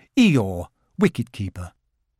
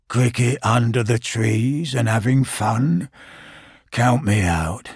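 slow, lower, gloomy donkey grumble; grumbling Eeyore; 3 semitones lower, slower than usual. Acted. Eeyore refusing to play cricket, slow gloomy grumble. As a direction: drag it out like a sulky old donkey. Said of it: "grumbled Eeyore".

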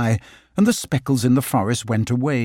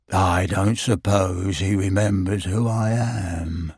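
mopey Eeyore; 5 semitones lower, slower than usual. Acted. Eeyore voiced in story.

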